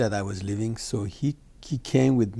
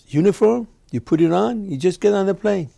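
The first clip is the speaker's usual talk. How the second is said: raised, loud eager pitch; excited urging; 9 semitones higher, 9 dB louder than usual. Retold or imitated. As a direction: push the plan hard and high. Friend urging disguise plan.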